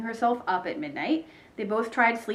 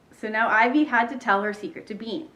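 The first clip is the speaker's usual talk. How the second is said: usual speaking voice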